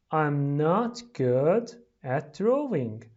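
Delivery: slow, carefully separated words. Shown as a demonstration. Teacher reading for pupils to repeat, slowly. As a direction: say each word slowly and distinctly.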